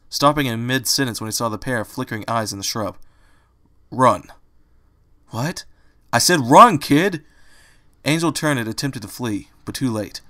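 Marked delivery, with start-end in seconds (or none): 3.9-7.2 a loud, sharp order to run; urgent shout. A narrator's reading. Angel orders Fluttershy to run from the attacker, shouting. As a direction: yell it as the danger strikes.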